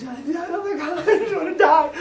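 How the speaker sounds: high whining despair, laughing; miserable whine. Retold or imitated. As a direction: kid crawling through the dirt, high miserable whine. Crawling in disgust.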